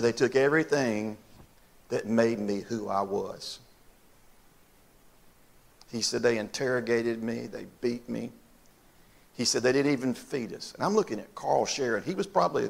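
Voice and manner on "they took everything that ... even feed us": subdued, softer voice, leaving pauses; hushed, grave retelling. Retold or imitated. Preacher retelling Carl's captivity to the congregation, hushed and grave. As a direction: let the hard memory out quietly.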